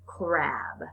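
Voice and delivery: ordinary talk, nothing marked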